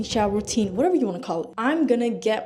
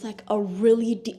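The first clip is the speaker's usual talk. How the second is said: usual speaking voice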